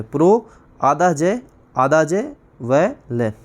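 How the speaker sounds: प्रो आधा जय आधा जय व ल, slow and spaced; प्रो, आधा जय, आधा जय, व, ल, drawn out; slow spelling. Shown as a demonstration. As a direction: dictate प्रोज्वल part by part. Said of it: "वैसे प्रोज्वल होता है".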